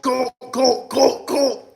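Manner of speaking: high, put-on screeching voice; a high, mocking caricature voice chanting cult; mock liberal scream. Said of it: "they scream".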